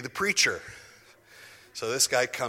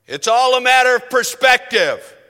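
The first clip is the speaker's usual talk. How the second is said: booming, much louder and higher pulpit declaration; imitating a loud fiery preacher; vociferous preacher; 13 semitones higher, 19 dB louder than usual. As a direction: thunder it like a fiery old preacher. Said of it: "he could get very vociferous".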